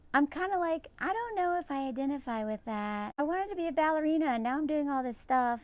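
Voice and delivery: high, airy imitation of a trad wife; mock trad wife.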